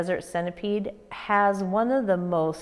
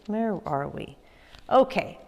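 usual speaking voice

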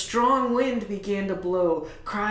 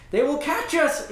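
high, loud cry of alarm; young woman warning others in fear; frightened yell; 8 semitones higher, 4 dB louder than usual. Acted. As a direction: cry it out in panic. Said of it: "a young woman yelled".

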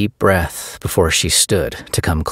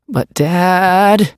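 put-on high, loud, drawn-out teen whine; whiny stretched groan; 13 semitones higher, 5 dB louder than usual. A narrator's reading. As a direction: stretch it out like a sulking teen girl. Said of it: "Monica groaned, her voice stretched out".

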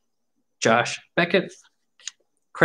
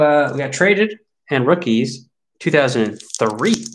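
usual speaking voice

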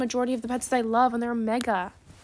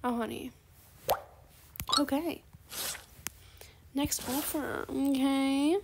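usual speaking voice

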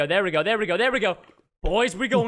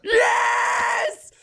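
very high, jubilant shout; real high-pitched cheer; victory scream; 13 semitones higher, 3 dB louder than usual. Real, not acted. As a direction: scream in triumph as you win. Completes the map.

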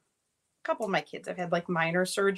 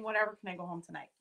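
fast and softer, tossed off; quick flat retelling; 3 semitones lower, 7 dB quieter, much faster than usual. Retold or imitated. Mom repeats son's answer, quick and soft. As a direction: rattle it off under the breath. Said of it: "he was honestly saying".

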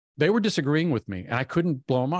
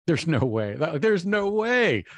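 slow, rising disbelief, pitch jumping higher; stunned disbelief; 6 semitones higher, slower than usual. Retold or imitated. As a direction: say it like you can't believe the letter. Disbelief at the admission letter.

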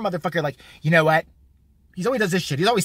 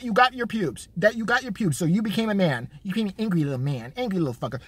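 ordinary delivery

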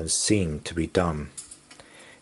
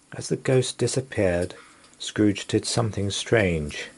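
usual speaking voice

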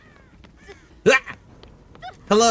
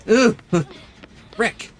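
ordinary talk, nothing marked

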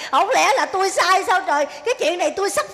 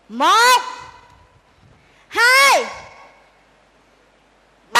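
counting out loud; 3 semitones higher, 3 dB louder, much slower than usual. Acted. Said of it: "Tôi la đến tiếng thứ ba".

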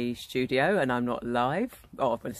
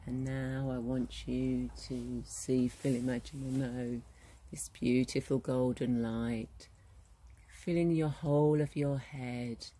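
guided meditation; 5 dB quieter than usual. Acted. Guide leading listeners into relaxation at the well, guided meditation voice. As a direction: ease the listener gently into calm, unhurried.

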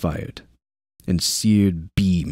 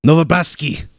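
loud urgent yell; 9 semitones higher, 4 dB louder than usual. A narrator's reading. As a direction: yell his name in alarm. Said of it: "Roan yelled".

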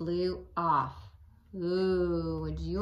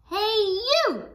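loud, very high squeaky call; high grouchy shout; 13 semitones higher, 7 dB louder than usual. Acted. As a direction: squeal a tiny challenge. Ladybug challenges elephant.

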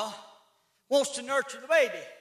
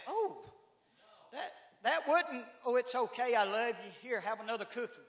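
soft, gentle coaxing; quiet, indulgent mother's tone; coddling mama; 8 dB quieter than usual. Retold or imitated. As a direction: soothe the child and offer a cookie. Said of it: "mama soothing".

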